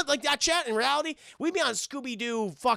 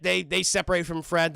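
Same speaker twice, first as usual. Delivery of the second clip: usual speaking voice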